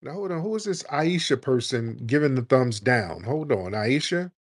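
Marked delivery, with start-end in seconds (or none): none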